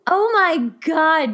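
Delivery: drawn-out, emotional oh my God; moved disbelief. Real, not acted. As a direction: let it stretch out, overcome. Reacting to the emotional story.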